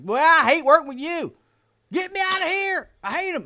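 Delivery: high, loud puppet complaint; a high puppet whining to get away, loud. Retold or imitated.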